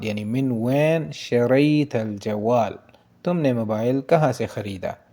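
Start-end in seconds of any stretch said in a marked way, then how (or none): 0.2-2.8 मिन वैन शरईतल जवाल stretched out slowly; slow full sentence. Shown as a demonstration. Teacher slowly modelling the whole Arabic question for students. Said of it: "तो आप बोलेंगे".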